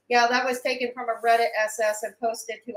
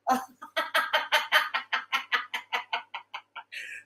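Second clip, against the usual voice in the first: long helpless laugh; 5 dB quieter than usual. Real, not acted. Long laugh after the joke.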